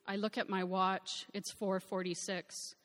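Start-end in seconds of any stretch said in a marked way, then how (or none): none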